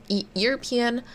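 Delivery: usual speaking voice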